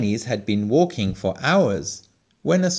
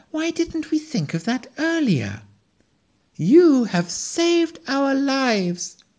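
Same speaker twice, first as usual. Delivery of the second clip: grateful pleading squirrel; 11 semitones higher than usual. A narrator's reading. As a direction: beg them to come, full of gratitude. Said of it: "pleaded the squirrel".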